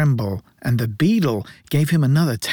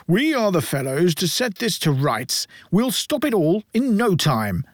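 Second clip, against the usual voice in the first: smug board; 5 semitones higher than usual. A narrator's reading. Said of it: "said the board".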